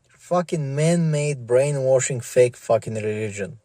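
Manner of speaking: ordinary talk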